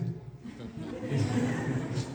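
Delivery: breathy chuckle; a quiet airy laugh; soft laugh. Real, not acted. Monk laughs recalling his guru's reply.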